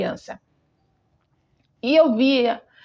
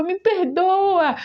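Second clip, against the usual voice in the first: higher, drawn-out plea, lightly imitating tears; retold tearful plea; 6 semitones higher, much slower than usual. Dreamer begging God's forgiveness, mock-tearful plea. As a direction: plead slowly, as if recalling tears. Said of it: "E eu chorava".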